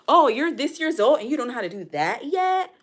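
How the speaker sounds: a mocking imitation of someone doubting you; mocking judgy voice.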